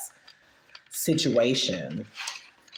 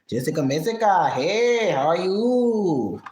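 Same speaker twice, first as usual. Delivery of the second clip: higher, louder, slow singsong greeting; Natter imitation; 4 semitones higher, 4 dB louder, slower than usual. Greeting a viewer by name, imitating another streamer's slow singsong. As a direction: greet a viewer the way Natter would, stretched and sunny. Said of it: "That's my Natter imitation".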